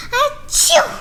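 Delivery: big sudden sneeze. Acted. Gerald sneezes.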